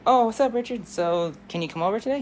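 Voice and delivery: high, lifted greeting; cheerful schoolgirl. Acted. Girl greeting her friend on the phone, perky. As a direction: perk up at hearing a friend. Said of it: "And she's like".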